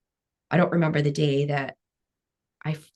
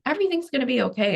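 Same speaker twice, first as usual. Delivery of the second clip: higher, quick mock reassurance; imitating reassuring dad; 9 semitones higher, faster than usual. Dad comforting his daughter face down in a pillow, imitated reassurance. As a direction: lightly voice a dad's hurried comfort. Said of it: "when my dad's going".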